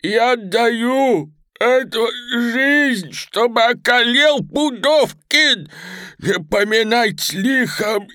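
high, loud, drawn-out calling; old man's straining cry. Acted. Old hostage shouts his farewell from window, high straining cry. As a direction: call out last wishes at the top of the voice. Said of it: "слабо кричал старик".

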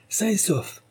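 high excited exclamation; exclaiming. A narrator's reading. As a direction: call out the good news. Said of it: "s'écria-t-il".

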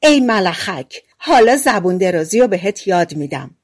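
usual speaking voice

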